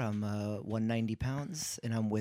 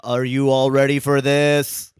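loud hype-up shout; 2 semitones higher, 13 dB louder, slower than usual. Acted. Stripper hypes the crowd.

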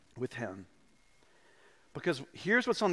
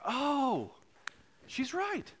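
sheepish realisation; 10 semitones higher, 6 dB quieter, slower than usual. Retold or imitated. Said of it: "And I was like".

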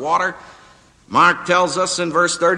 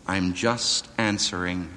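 calm gentle reply; 8 semitones lower, 8 dB quieter than usual. Retold or imitated. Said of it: "And he's answering".

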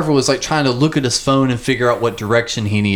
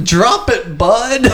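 nervous laugh; 7 semitones higher, 3 dB louder, much slower than usual. Retold or imitated. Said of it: "And I'm just like".

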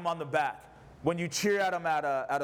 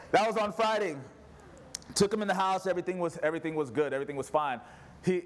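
ordinary talk, nothing marked

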